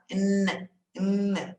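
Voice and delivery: a long, humming "N"; isolated nasal "N" for the class; stressed N. Shown as a demonstration. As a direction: hold the "N" sound firmly. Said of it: "Esse N é bem acentuado".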